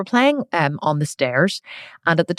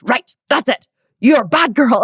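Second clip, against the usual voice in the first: higher, louder stern scolding; angry dad; 8 semitones higher, 6 dB louder than usual. Retold or imitated. Dad scolding his daughter, angry and loud. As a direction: scold the child hard. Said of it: "he goes".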